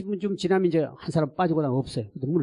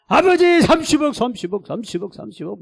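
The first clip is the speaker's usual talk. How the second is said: loud cries fading down; frantic dawn prayer for thirty billion; fervent crying out; 7 semitones higher, 9 dB louder than usual. Retold or imitated. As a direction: shout the prayer, then let it sink. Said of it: "둘이가 미쳤어".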